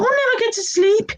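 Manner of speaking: high whiny moan; whining Henry.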